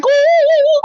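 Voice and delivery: high, loud mock bird hoots; imitating a bird. Cohost mimicking the bird attacking Wendy, high and loud. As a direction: squawk it like the bird. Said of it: "She was like".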